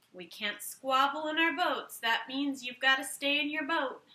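ordinary delivery, nothing marked

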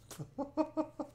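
a burst of real laughter. Real, not acted. Narrator laughing at the silly monopods, real laugh.

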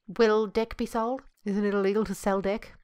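ordinary talk, nothing marked

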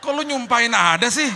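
high, loud, slow and laughing; laughing tease. Retold or imitated. Patient teasing the doctor for wishing sores on him, laughing. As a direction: scold him jokingly through laughter.

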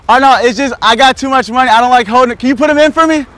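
high, loud showy holler; loud mock bragging. Joker playing too rich to hold things, loud mock bragging. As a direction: project it cheekily across a distance.

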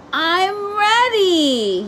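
a small frog bursting to go, yelling it out; eager little frog yell. A narrator's reading. Froggy shouting back to his father, high eager yell. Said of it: "yelled Froggy".

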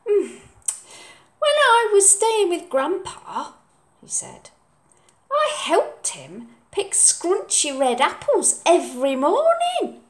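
put-on high, bright child voice; little boy Oliver. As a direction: play the eager small boy. Oliver's voice in story.

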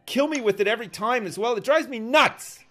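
loud furious rant at nerds; raging gamer. Real, not acted. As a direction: yell it out, furious and high. Raging at other players.

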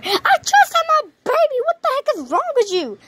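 high, indignant squeal; outraged new mother. Acted. New mother yelling at the rude doctor, high and outraged. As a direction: demand answers, offended.

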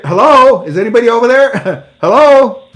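shouting hello at the minister, hammed-up; mock calling out. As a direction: call into an empty room, teasing.

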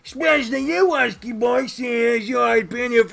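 high, loud cartoon voice; pushed-up, blaring host; boisterous restaurant host. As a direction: needle the guests at shouting volume.

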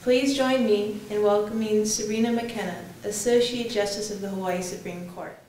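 ordinary talk

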